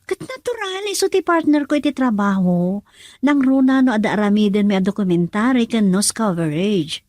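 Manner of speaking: loud defensive insistence; defiant. Acted. Daughter loudly defends her work partner to her mother. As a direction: stand up for him loudly.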